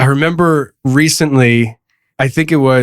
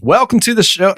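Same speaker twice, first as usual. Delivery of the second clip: higher-pitched try at a Southern accent; attempted Southern accent; 6 semitones higher than usual. Shown as a demonstration. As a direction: have a go at a Southern welcome. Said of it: "I can't do it on demand".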